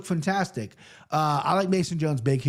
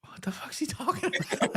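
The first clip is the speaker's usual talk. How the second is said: unvoiced, baffled whisper to himself; whispered inner thought; 10 semitones higher, 6 dB quieter than usual. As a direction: whisper it as a confused kid. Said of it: "just looking forward going".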